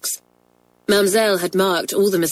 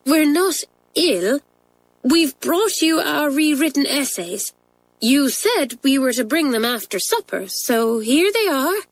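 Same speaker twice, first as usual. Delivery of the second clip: high, hesitant schoolgirl voice; rather trembling; 8 semitones higher than usual. A narrator's reading. Pat handing late essays to teachers, nervous. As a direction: explain timidly, slowing at the start and end. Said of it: "in a rather trembling voice".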